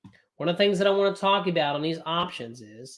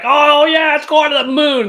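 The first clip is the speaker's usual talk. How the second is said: higher, louder mock of excited hype; 9 semitones higher, 12 dB louder than usual. Streamer mocking YouTubers, hammed-up high hype voice. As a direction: ham up a hyped YouTuber. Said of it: "One day they're like".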